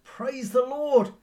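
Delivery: high, loud, slow shout of praise; joyful shout. Retold or imitated. Elderly lady finding the groceries on her porch, shouting loud and high. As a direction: cry it out in delight, drawn out. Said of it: "noted a large bag of groceries and shouted".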